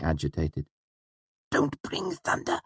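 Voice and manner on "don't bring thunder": very high, hushed and pressing; begging him not to; urgent plea. Acted. Wynne urgently begs Elodin not to bring thunder. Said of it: "he said urgently".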